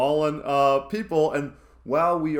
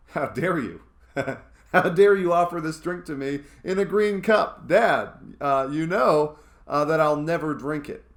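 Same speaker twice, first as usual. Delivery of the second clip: imitating a three-year-old's outraged tantrum, louder; mock-indignant toddler shouting at dad; 4 semitones higher, 6 dB louder than usual. Toddler refusing a smoothie in a green cup, mock outrage. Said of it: "much more screaming and crying".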